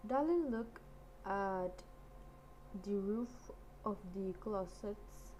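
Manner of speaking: ordinary delivery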